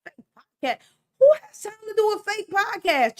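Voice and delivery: high, loud, baffled outburst; incredulous disbelief. Retold or imitated. Told about fake podcasts, reacting in loud disbelief. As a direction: throw it back in mock disbelief. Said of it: "And I was like".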